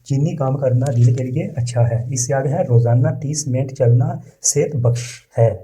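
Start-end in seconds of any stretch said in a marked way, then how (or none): none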